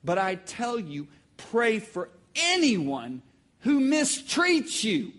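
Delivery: very slow, rising-force delivery; emphatic scripture reading. Real, not acted. Preacher reading Jesus's words to the congregation, slow emphatic reading. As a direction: weigh out each word of the verse.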